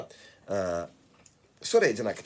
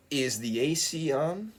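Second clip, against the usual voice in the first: "Is the A C on" slow and higher, rising to the end; model question; 4 semitones higher, slower than usual. Shown as a demonstration. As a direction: model "Is the A C on" slowly for learners, lifting "on". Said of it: "Listen, look here".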